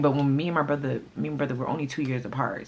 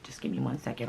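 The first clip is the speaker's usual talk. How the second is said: quiet, breathy-free hushed request; hushed aside; 7 dB quieter than usual. Retold or imitated. To the boyfriend peeking in while on the phone, hushed. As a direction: keep it down, you're on the phone. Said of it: "I told him to give me one second".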